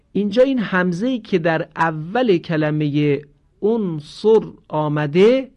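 normal talking voice

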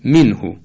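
منهو as "minhu"; recitation-correct منهو, short i then u; exact Arabic vowels. Shown as a demonstration.

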